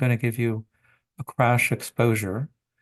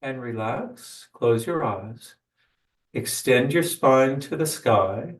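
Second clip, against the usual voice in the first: guided meditation. Acted. Leads a meditation.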